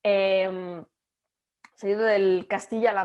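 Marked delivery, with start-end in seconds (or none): none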